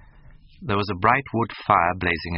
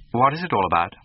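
put-on higher and louder, a spoiled young man's whine; petulant cry; 6 semitones higher, 6 dB louder than usual. A narrator's reading. Young man snapping at his friend, petulant and loud. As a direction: cry out like a sulky youth. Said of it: "cried Dorian, in his petulant way".

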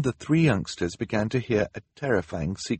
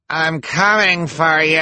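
high, loud, drawn-out taunt; menacing cry; 9 semitones higher, 7 dB louder, slower than usual. A narrator's reading. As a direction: call out over the cabinets to the fleeing children. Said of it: "Esme cried, her voice coming over".

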